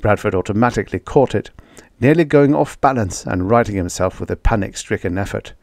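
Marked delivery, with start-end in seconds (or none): none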